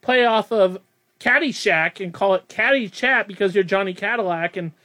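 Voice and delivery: normal talking voice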